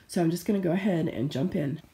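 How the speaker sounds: ordinary talk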